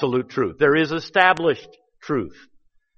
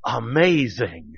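awed; 4 semitones lower, 5 dB quieter, slower than usual. Retold or imitated. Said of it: "the woman just said".

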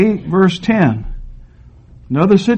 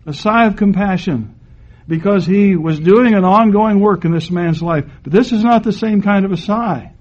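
usual speaking voice

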